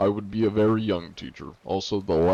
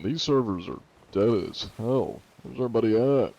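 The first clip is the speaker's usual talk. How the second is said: ordinary delivery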